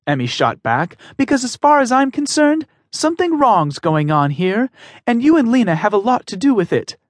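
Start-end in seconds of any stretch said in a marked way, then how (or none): 1.2-7.0 a daughter standing up to a bully, high and strained; young woman pleading. Acted. Emmy confronts Mister Meyer, firm high pleading voice. Emmy accuses Trip and Lena.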